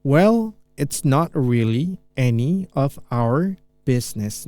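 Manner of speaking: slow, deliberate teacher read; very slow model line. Shown as a demonstration. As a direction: drag it out for learners to follow. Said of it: "Ini saya pelanin gitu ya".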